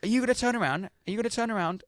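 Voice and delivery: angry man demanding the bus turn round; demanding passenger. Retold or imitated. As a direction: press the driver over and over. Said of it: "he goes".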